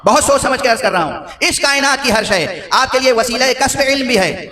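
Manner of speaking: high-pitched sermon delivery, climbing to a peak; impassioned preaching. Real, not acted. Preacher telling the congregation all creation teaches, impassioned and rising. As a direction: preach it high, building to the climax.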